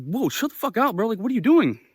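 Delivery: high-pitched indignant retort; reenacted indignant protest. Telling the man off, high indignant reenactment. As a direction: act out an incredulous protest, pitched well up.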